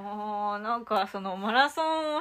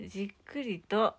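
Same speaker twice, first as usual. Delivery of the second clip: whispering; 7 dB quieter than usual. Real, not acted. Guessing the answer quietly.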